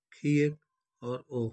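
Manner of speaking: usual speaking voice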